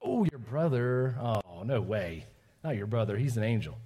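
slow, drawn-out, low teasing denial; mock disbelief. Teacher to child mad at brother, playful mock disbelief.

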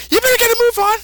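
put-on very high, squeaky old-man nag. The old Kai telling Goku to hurry, in a very high old voice. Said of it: "and says".

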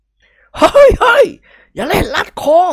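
very high, very loud yell; panicked slow bellow; loud shout. A narrator's reading. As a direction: yell to stop the chokehold. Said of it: "ท่านเจ้าคุณตะโกนลั่น".

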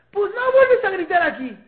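high, very loud scolding shout; yelling mom. Acted. Mom yelling at her child about yelling, loud angry shout. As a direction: yell the ban at the child. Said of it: "¿Quién es la que está gritando?".

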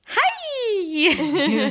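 mock high-pitched drawn-out hi; exaggerated sky-high, stretched-out squeal; sudden squeal. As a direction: imitate a friend's sudden shrieky greeting. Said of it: "all of a sudden she'll just be like".

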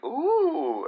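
high squeaky ooh of delight; high imitated ooh. Imitating his friend Mike's ooh, high squeaky. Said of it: "He's like".

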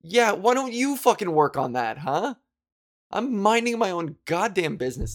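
retold comeback. Retelling her reply on the streetcar, sarcastic and indignant. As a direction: fire it back sarcastically. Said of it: "I was like".

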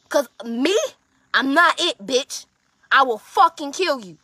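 loud, high, heated telling-off; angry retelling. Retold or imitated. Telling off the woman who keeps hitting, angry and loud. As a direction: raise the voice and lay into them, punching out each demand. Acted quarrel, telling her off.

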